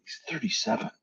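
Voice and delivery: ordinary delivery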